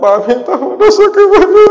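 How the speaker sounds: loud, high mock wailing; istri menangis, ditirukan. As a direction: wail an apology, hammed-up and rising. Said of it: "Nangis sang istri yang minta maaf". (tangisan istri yang dilebih-lebihkan)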